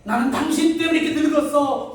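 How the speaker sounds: hammed-up whine of being wronged; whiny mock complaint. Pastor imitating a spouse blaming their partner, hammed-up whine.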